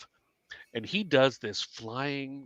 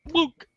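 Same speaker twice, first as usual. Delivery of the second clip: high cartoon boonk for a limp arm; mock boonk noise. Retelling how her arm folded, a high mock boonk noise. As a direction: make the floppy arm sound. Imitated sound of arm giving way.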